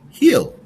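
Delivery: "Heel" said high and loud. Shown as a demonstration. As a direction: call out "Heel" clearly. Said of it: "Listen as I say a word".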